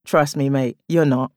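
ordinary delivery, nothing marked

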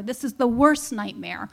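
ordinary talk

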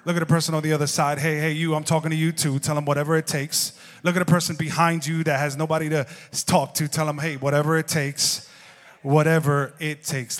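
loud, driving preacher delivery; rally the room like a pastor; full-force preaching. Acted. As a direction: pastor firing up his congregation, loud.

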